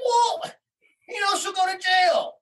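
very high, incredulous retort; exasperated alarm. Retold or imitated. Parent answering investigators about mother's jail risk, exasperated alarm. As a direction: throw the voice up in retold exasperation.

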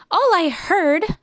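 slow, high, mildly scornful retort; a touch of haughty snap; snappish kangaroo. A narrator's reading. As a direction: dismiss it with a drawn-out start. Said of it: "snapped the big kangaroo".

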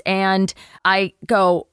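usual speaking voice

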